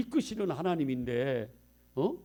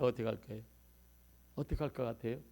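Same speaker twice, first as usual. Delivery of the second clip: normal talking voice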